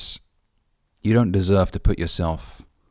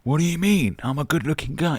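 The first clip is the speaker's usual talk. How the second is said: high, indignant mock outrage; offended guy; 9 semitones higher than usual. Mocking rejected guys who take offence, high indignant whine. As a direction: play the wounded pretty boy, whining. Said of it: "you see a lot of guys get so offended".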